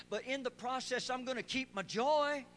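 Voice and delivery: high, loud shouting preacher; full-force preaching. Acted. Pastor shouts to the church about keeping joy, high and loud. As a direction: preach it out at the top of your voice.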